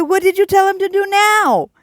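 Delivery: half-laughing high exasperated plea. Retold or imitated. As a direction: throw the question up to heaven in comic disbelief. Exasperated question to God.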